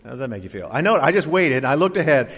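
normal talking voice

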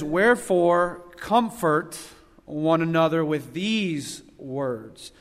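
slow, measured Bible reading; scripture reading. Acted. Preacher reading the verse to the church, slow and measured. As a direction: read the verse slowly, weighing each word.

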